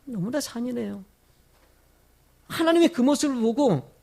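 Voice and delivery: ordinary talk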